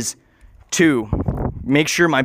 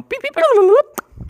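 high, slow electronic beeps, like keys; imitated beeps; 4 dB louder than usual. Typing at the volcano laptop, high beeps. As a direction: play the beeping keyboard with your voice.

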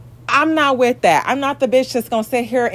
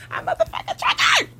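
sudden much higher cry of frustration; real outburst; 4 dB quieter than usual. Real, not acted. Venting to viewers about her ex, a sudden fed-up cry. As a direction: let it burst out, fed up. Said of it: "I'm triggered right now".

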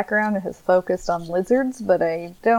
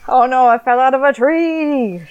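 iguana; 4 semitones higher, 4 dB louder than usual. Pretend. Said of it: "My iguana impression".

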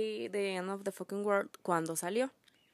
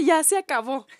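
louder and higher, a mock cry of relief; excited exclamation; 6 semitones higher, 10 dB louder than usual. Sister reliving the series finale, excited louder relief. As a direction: shout that it's finally over. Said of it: "Pero dije".

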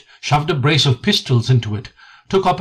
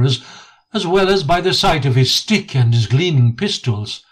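usual speaking voice

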